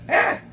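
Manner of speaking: short loud mock cry; mock yell. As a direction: yelp as if hit. Under fire in the skit.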